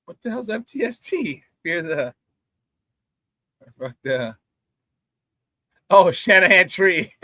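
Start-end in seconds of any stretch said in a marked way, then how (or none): none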